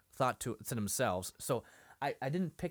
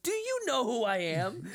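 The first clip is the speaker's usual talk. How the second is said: high-pitched mock indignation; retold upset indignation; 10 semitones higher, 3 dB louder than usual. Shmuel to the man who hushed him, mock upset. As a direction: imitate an affronted man pulling rank. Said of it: "Shmuel gets so upset".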